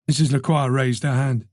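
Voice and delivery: usual speaking voice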